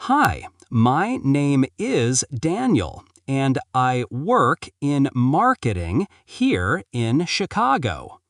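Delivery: slow it down word by word; slowly. Shown as a demonstration. Teacher models the slow take of the introduction. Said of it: "Say it slowly".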